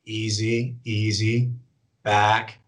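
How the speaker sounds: low, measured, drawn-out soothing command; calm short send. Shown as a demonstration. Handler sending dog on short blind retrieve, calm. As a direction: ease the dog out gently, pitched low. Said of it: "might be a fifty, sixty yard blind".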